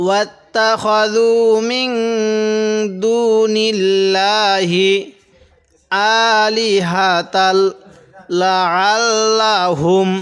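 slow chanted tajweed recitation; Quran recitation. Acted. Teacher reciting the verse for students, slow chant. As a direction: recite it slowly with long held vowels.

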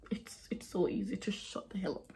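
breathy mock whisper of an aside. Real, not acted. To viewers about rude commenters, a whisper. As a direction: whisper it like a cheeky secret.

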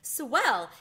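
high, loud happy cheer; boy cheering the good news; excited. A narrator's reading. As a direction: share the thrill. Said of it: "just as excited as Ralph".

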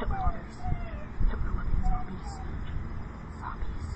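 very high, soft squeak; mock them in a tiny high voice; squeaky mock voice. Vlogger mocks the Nazi zombies in a tiny squeak.

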